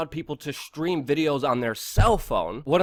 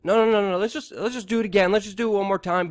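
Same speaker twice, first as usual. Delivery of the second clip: mock placating; 6 semitones higher, faster than usual. Producers keeping guest from walking out, mock placating. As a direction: imitate producers hurriedly talking someone round.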